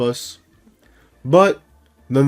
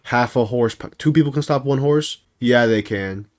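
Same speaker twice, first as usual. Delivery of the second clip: ordinary talk, nothing marked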